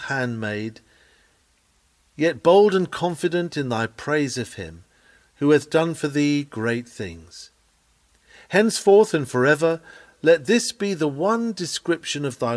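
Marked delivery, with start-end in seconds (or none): none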